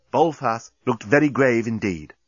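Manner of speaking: ordinary delivery, nothing marked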